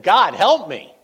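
loud, urgent cry to God; desperate plea. Retold or imitated. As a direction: call out for help in danger. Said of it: "I just said".

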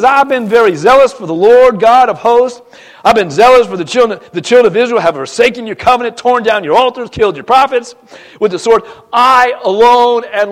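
loud, pleading protest to God; loud complaint. Acted. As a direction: cry out your defence, aggrieved. Elijah's complaint acted out.

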